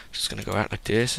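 normal talking voice

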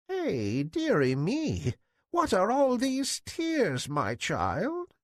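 put-on old beggar woman soothing tearful Cinderella. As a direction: hobble into it like a soothing granny. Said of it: "said the old woman".

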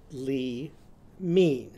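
"Lee mean" in two separated syllables, long ee in both; changed vowels. Shown as a demonstration. Teacher modelling lemon with Eve's sound, "Lee mean" split with long ee. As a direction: say "Lee mean" apart, ee in each half. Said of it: "what's it gonna be called?".

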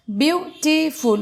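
"বিউটিফুল" slowly in three beats, বিউ-টি-ফুল; syllable by syllable. Shown as a demonstration. Teacher recapping a three-syllable word, "বিউটিফুল" said syllable by syllable. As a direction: separate each syllable of "বিউটিফুল". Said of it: "three syllabled word".

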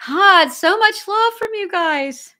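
high, loud, slow burst of delight; overjoyed gush. Real, not acted. Streamer thanking her chat, overjoyed and gushing. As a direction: gush with real joy over the donations. Overwhelmed by viewers' donations.

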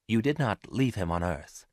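plain reading voice

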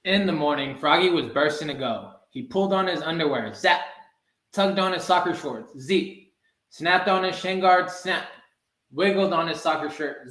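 ordinary delivery, nothing marked

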